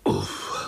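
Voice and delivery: low puff of relief; relieved "uf". A narrator's reading. Spiderlings relax after uncle's escape, relieved. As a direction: let out a short, low "phew". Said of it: "oddechli si pavoučci sborem".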